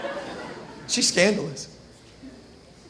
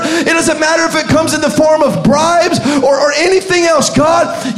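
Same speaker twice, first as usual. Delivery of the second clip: fervent declaration; 2 semitones higher, 7 dB louder than usual. Acted. Joseph vowing loyalty to God, shouted. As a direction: cry it out like a sworn promise. Preacher voices Joseph praying.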